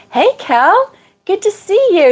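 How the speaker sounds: high, bright hello; high cheery greeting. Real, not acted. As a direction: greet a friend gladly. Greeting a viewer.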